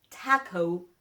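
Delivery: "taco" with flat English a and gliding o; "taco" with English vowels; wrong English taco. Shown as a demonstration. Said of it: "So it's not A-O".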